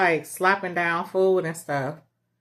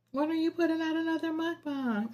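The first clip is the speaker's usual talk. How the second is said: high quoted viewer; 11 semitones higher, 5 dB quieter than usual. Retold or imitated. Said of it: "they was like".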